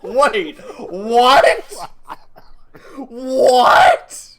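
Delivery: high, loud disbelieving cry; rising shock, almost laughing; stunned disbelief. Retold or imitated. Me reacting to the officer's remark in loud shock. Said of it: "And I'm like".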